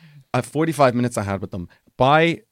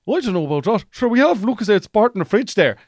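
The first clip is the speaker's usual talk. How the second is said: higher, louder rural Irish lad; mock Irish country accent; 9 semitones higher, 4 dB louder than usual. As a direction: send up an Irish culchie's accent. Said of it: "culture versus city person".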